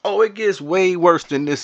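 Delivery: excited. Real, not acted. Hyped streamer teasing his audience about the map, excited.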